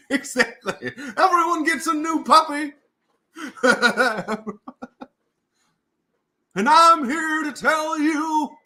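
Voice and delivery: pander to the crowd from a stage; folksy pandering politician. Pretend. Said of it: "I love the politician voice".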